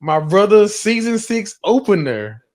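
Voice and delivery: loud, high announcer call; hype introduction. Real, not acted. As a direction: hype up your co-host for the opener. Host hypes up the season opener.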